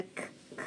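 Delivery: "k, k" as two crisp voiceless clicks; isolated sound. Shown as a demonstration. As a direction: say "k" as a bare consonant, twice.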